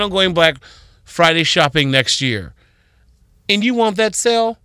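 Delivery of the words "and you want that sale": high, punchy push to just go; emphatic urging. Real, not acted. Advising next year's shoppers, high emphatic urging. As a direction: urge them to get there, high and bright.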